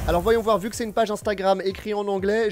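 ordinary talk

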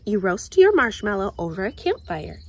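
ordinary delivery, nothing marked

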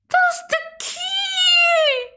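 very high, stretched-out whiny cry; upset wail. Real, not acted. Gamer upset over a key, high drawn-out wail. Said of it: "getting upset over a key".

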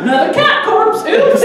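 a hoarder shrugging off a corpse; airy oops, laughing; mock casual hoarder.

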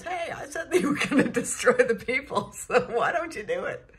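loud, high indignant complaint breaking into laughter; hammed-up outrage, laughing through it; indignant Jonah. Jonah gets mad at God.

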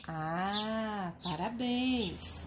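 ordinary talk

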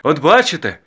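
high, forceful exclamation; loud reproach. A narrator's reading. Father rebuking the matchmakers, loudly. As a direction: scold them with ritual bluster.

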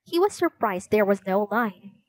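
ordinary delivery

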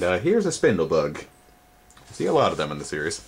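ordinary delivery

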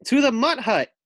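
loud, high command; giving an order. Acted. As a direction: call the troops forward, loud and high. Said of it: "Buffy orders".

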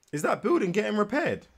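usual speaking voice

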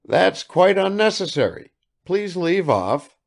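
father embarrassed before the guest. Acted. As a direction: cover your feelings with fuss. Father hushing wife.

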